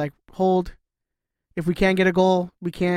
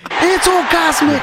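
high, loud, slow comic shout; hammed-up shout; 10 semitones higher, 5 dB louder, slower than usual. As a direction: bellow it like a cosmic prophet. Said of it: "Let's move on".

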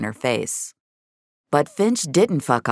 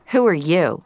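flat; 5 semitones higher, 4 dB louder than usual. A narrator's reading. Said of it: "the girl asked more flatly".

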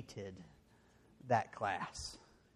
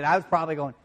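ordinary talk, nothing marked

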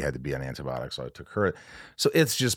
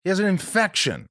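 insistent reply; 7 semitones higher, 3 dB louder than usual. Retold or imitated. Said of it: "I'm like".

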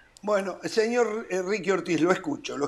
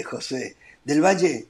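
ordinary talk, nothing marked

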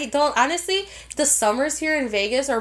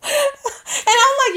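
ordinary delivery

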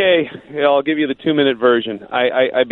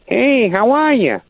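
prank caller voice; 10 semitones higher than usual. Retold or imitated. Mystery caller asks the sportscaster about rubber boots, high mock voice. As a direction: put on a strange high voice like a weird late-night caller. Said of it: "and this guy says".

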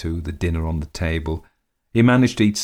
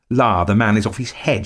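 higher, louder shrill outcry; 4 semitones higher, 6 dB louder than usual. A narrator's reading. Lady exclaiming that he has lost his mind, shrill. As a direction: pitch it up in scornful alarm. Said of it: "shrilled my lady".

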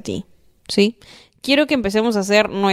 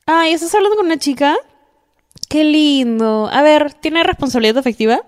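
high, louder, delighted questions; gushing friend; 6 semitones higher, 3 dB louder than usual. Acted. Friend hears Bebita is talking to a girl, gushing. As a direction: coo over a friend's new girl, then vet her. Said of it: "con mujeres yo siento que sería algo más como".

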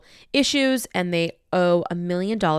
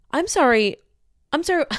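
higher-pitched indignant "excuse me" tone; taken aback, voice jumping up; incredulous; 8 semitones higher, 4 dB louder than usual. Retold or imitated. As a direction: retort in offended disbelief. Incredulous retort to her child.

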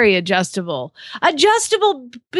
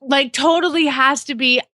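put-on American teenage girl, stretched words, pitch swooping up; hammed-up teenage girl voice; 2 semitones higher than usual. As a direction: gush like a teen girl with drawn-out words. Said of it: "teenage girl voice".

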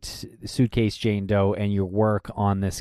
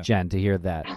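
lower-pitched, dry mock shock; heavily sarcastic; 4 semitones lower than usual. Cohost pretending surprise at Jen's police troubles, sarcastic. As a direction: finish the feigned surprise drily. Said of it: "That was heavily draped in sarcasm".

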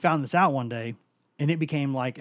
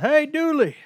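much higher, loud drawn-out hey, Dooley; mocking Malik's greeting; 11 dB louder than usual. Malik always greeting the coach, loud high stretched call. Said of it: "He's always like".

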